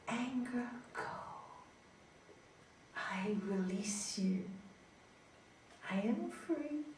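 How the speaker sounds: slow, soft meditation voice sinking to a whisper; guided meditation. Acted. Teacher leading a child to release anger, soft and slow. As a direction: guide the child into calm, breath by breath. Leads a breathing exercise.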